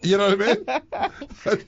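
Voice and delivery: slow laughing words. Real, not acted. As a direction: laugh through the question. Laughing, slowed.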